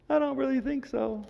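high mock doubtful voice; skeptical. Imitating a skeptical listener, high and doubtful. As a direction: play the doubter, unconvinced.

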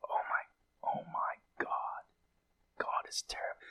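breathless whispered horror; terrified whisper. Acted. The church reading the seals, whispering in terror. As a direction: gasp it out under your breath, terrified. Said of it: "and they're like".

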